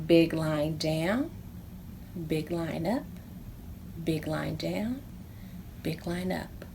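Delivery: calm handwriting count; guiding the writing. Shown as a demonstration. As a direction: guide little hands line by line. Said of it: "start at the top".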